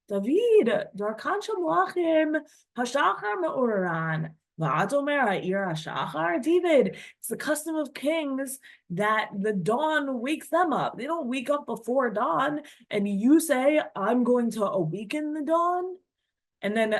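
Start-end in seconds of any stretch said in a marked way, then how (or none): 0.1-6.4 a hint of a nagging tempter, higher; lightly voiced tempter needling King David; pestering evil impulse. The evil impulse nagging King David, lightly voiced and higher. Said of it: "his evil impulse would pester him and say". 6.4-16.0 a hint of a nagging tempter, higher; lightly voiced tempter needling King David; pestering evil impulse. The evil impulse nagging King David, lightly voiced and higher. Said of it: "his evil impulse would pester him and say".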